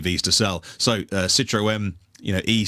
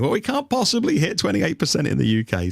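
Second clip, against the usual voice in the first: imitated protest, pitched up; high-pitched mock grumble of disbelief; mock complaining; 7 semitones higher than usual. Car makers protesting an EV sales target, mock complaining. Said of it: "they're all complaining".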